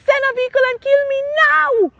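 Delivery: high, pleading anguished outcry; desperate cry. Retold or imitated. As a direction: beg to die, at the end of the rope. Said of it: "And I said".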